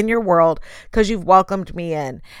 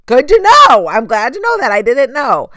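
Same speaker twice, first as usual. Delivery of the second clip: mock surprise; 6 semitones higher, 8 dB louder than usual. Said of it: "I'm like".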